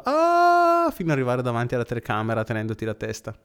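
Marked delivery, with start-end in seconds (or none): 0.0-0.9 high mock scream; retold scream. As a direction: scream like Home Alone kid.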